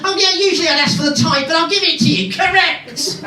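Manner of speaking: high, fast hammed-up host voice; mock host. Comic mimicking the host letting a contestant off, high fast voice. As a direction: rattle it off like a cheesy host.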